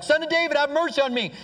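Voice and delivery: high-pitched beggar's cry; acted blind man hailing a passer-by; imitated calling out. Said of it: "calling out to Jesus".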